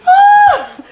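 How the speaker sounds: loud high shriek of laughter. Real, not acted. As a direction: scream with laughter.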